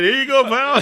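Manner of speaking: high, loud sarcastic hand-off; mock handing over. Mocking the friend while handing him the card, sarcastic. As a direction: toss it over with fake generosity.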